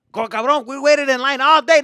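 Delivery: loud, high exasperated yelling; mock-scold your balking kid at full volume; angry dad. Dad to son at the front, exasperated shouting.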